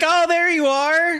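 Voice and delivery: put-on high, slow, loud child's voice; little girl voice. Daughter finding dad in ball pit, high child voice. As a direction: squeal like a delighted toddler. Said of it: "And she's like".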